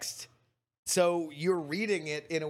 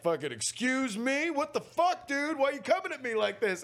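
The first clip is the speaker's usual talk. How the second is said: indignant; 8 semitones higher than usual. Retold or imitated. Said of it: "she was like".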